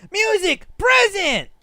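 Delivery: high, loud delighted calls; squealing with delight; excited high cries. Acted. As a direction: call it out like a thrilled kid. Character reacts to ringtone.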